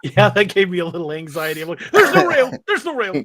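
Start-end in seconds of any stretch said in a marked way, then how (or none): 1.9-3.2 high loud yelp that fades on the repeat; mock panic. Friend retelling his fear of the steps, mock panicked. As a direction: squeal in pretend terror at the missing rail.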